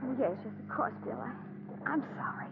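barely voiced, soft and small; meek hush. Wife to husband, cowed after his outburst, near-whispered. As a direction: shrink back and breathe the apology.

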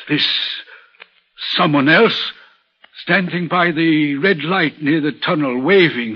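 ordinary talk, nothing marked

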